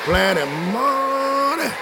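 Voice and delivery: slow, slurred "Plenty money"; mock drunk. Shown as a demonstration. Preacher demonstrating drunk speech to the congregation, slurred and slow. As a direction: slur "Plenty money" like a tipsy man. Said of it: "Say it like you're drunk".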